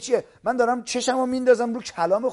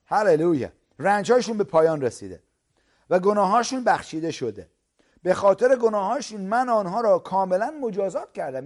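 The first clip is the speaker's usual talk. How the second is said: louder, ringing preacher's proclamation; preaching at full force; 5 dB louder than usual. Acted. Preacher proclaiming forgiveness to the congregation, full force. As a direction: proclaim it to the whole hall.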